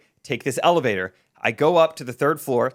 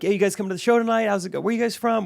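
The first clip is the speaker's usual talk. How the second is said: high-pitched, chirpy mock chitchat; casual small talk; 8 semitones higher than usual. Performer chats while reading a tearful fan, casual. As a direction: keep up normal chatter, pitched up. Forced normal chat with tearful girl.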